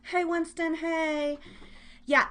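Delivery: normal talking voice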